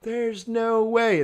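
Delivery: high, drawn-out words as if chuckling; retold hilarity, pitched high; mocking laughter. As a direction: imitate scoffing Europeans, lightly hammed up. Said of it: "they're laughing at me".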